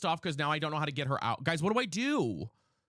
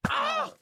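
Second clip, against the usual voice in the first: very high real scream; startled shriek; 5 dB louder than usual. Real, not acted. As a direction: shriek in sudden fright. Sudden attack in game.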